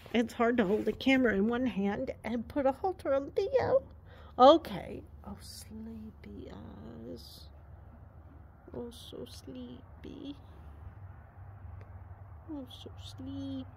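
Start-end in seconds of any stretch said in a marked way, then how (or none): 5.3-13.7 slow, soft whispered coo; tender whisper. Real, not acted. Owner cooing to her drowsy horse, slow soft whisper. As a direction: breathe it out slowly, barely there.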